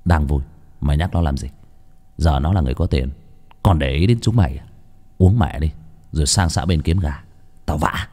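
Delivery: low, rough gang leader; dismissive thug boss; gruff boss. A narrator's reading.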